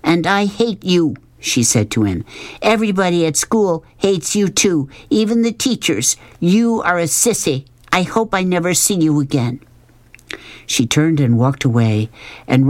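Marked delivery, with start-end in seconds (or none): 0.0-1.1 higher-pitched, restrained venom; cold hatred. A narrator's reading. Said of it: "in a dark, cold voice". 2.6-9.6 higher-pitched, restrained venom; cold hatred. A narrator's reading. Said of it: "in a dark, cold voice".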